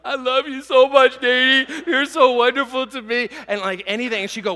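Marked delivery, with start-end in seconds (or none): 0.0-3.3 put-on high, loud, gushing little boy voice; gushing child. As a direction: squeal it like a delighted kid thanking his aunt. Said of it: "And I was like".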